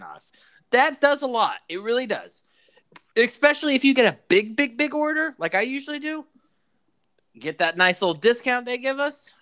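playful high-pitched plug; high put-on voice. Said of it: "the reason why I'm talking like this".

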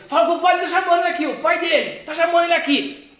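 fast, high relayed call; imitated calling out. Worker relaying that the father is calling, imitated call-out.

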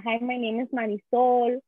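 put-on reciting newcomer English with a strong Spanish accent; simple classroom English in a thick Spanish accent; beginner's Spanish-accented English. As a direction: imitate a little kid's memorized introduction, Spanish-accented. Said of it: "so I go up and I say that".

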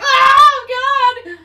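high, loud emotional "Oh, God!"; choked-up cry. Real, not acted. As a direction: burst out overwhelmed by the scene. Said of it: "I'm getting a little choked up".

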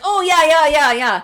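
high, loud, overeager yes-man; eager scammer voice. Retold or imitated. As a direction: gush agreement like a fake agent. Voicing the scam caller.

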